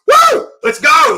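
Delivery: yelling to get pumped after a chili hit; hyped-up yell. Retold or imitated. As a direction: fire yourself up with a big woo. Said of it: "I'll drop a few of those and going".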